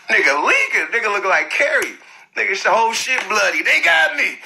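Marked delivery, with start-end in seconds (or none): 3.6-4.4 high, pained little "They got me."; mock wounded whimper. Retelling a bloodied guy, high mock whimper. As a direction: imitate a beaten man moaning "They got me". Imitating the beaten man.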